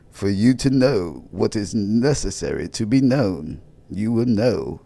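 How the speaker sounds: slow, loud mock-prophet proclamation; new persona. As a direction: intone grandly with drawn-out words. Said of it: "change my whole persona".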